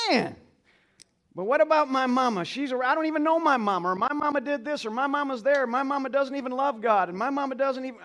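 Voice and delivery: imitating a doubting listener. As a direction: voice the pushback in a listener's protest. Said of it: "You might say".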